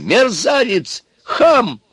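high, loud shrieked insults; furious yell. A narrator's reading. Baba Yaga curses the insolent Ivan, yelling. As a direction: hurl each word at full pitch. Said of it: "заорал Яга".

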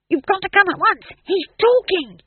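high, loud, breathless rush; excited urging. A narrator's reading. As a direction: hurry him along, bursting with news. Dwarf urges excitedly.

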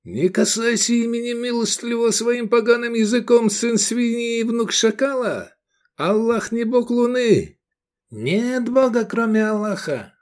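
high, sustained furious cry; outraged roar. A narrator's reading. Zealot rebukes the pagan over God's name, roaring. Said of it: "Тура проревел".

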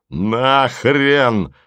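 протяжный сердитый выкрик; крикни, отмахиваясь от просьбы. A narrator's reading. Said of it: "закричал Голубович". (растянутый окрик)

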